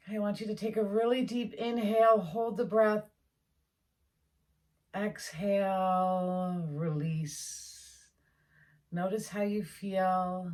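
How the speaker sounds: slow exhale cue, letting the word release trail off; calm breathing instruction, drawn out; breathwork guidance. Acted. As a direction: breathe them through it. Guides a breath exercise.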